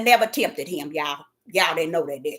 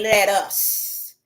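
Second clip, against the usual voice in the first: voice of God; 9 semitones lower, 8 dB quieter, slower than usual. Retold or imitated. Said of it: "he said".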